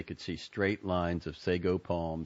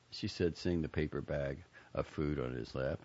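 plain reading voice

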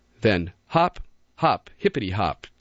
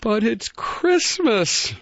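high, slow, moaning bunny voice; 12 semitones higher, slower than usual. A narrator's reading. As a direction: moan it as a lonely little rabbit. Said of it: "moaned Barrington".

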